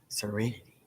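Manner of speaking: a soft, gasped, dreamy product name; breathy ad whisper. Acted. Host reading an ad to viewers, hushed and gasped. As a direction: sell it with a hushed gasp.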